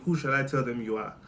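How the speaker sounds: normal talking voice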